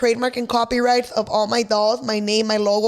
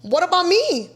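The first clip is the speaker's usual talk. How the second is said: higher and louder, outraged; indignant; 8 semitones higher, 3 dB louder than usual. Real, not acted. Outraged at roommates who left her with the rent, loud and high. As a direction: demand it, betrayed.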